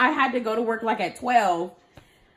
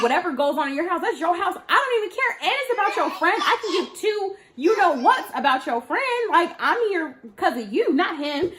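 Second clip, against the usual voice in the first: heated retort; 7 semitones higher than usual. Retold or imitated. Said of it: "And I'm like".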